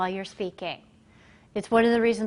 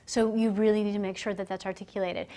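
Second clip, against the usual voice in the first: ordinary talk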